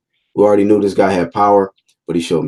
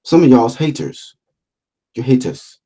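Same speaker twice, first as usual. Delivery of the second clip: mock British accent; 4 semitones higher than usual. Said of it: "sounds like a Nigerian accent or I can't do the British accent".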